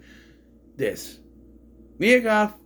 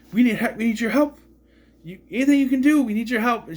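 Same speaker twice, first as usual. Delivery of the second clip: high, fast mock plea; imitated urgent begging, pitched up; insistent pleading; 8 semitones higher, 4 dB louder, faster than usual. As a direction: act out cops pestering someone for help. Acting out cops pestering Maxine.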